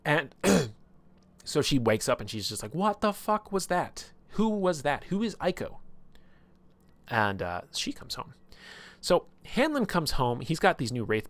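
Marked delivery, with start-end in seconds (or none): 2.7-5.8 high, slow, stunned disbelief; high bewildered outburst, slowly; shocked confusion. Retold or imitated. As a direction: blurt it out, rattled and lost after the dream. Waking confused from strange dream.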